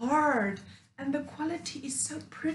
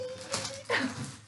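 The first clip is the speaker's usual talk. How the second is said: laugh; 11 semitones higher, 5 dB quieter than usual. Real, not acted. Laughing at empty pamphlet.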